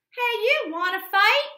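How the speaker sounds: loud, high dare; ladybug voice. Acted. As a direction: shout up at the whale. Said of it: "the grouchy ladybug said".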